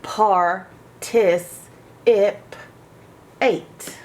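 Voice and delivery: "Par, tis, ip, eight" as separate beats, rising in volume to "ip"; syllable split. Shown as a demonstration. Teacher splitting "participate" for pupils. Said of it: "makes it short sound".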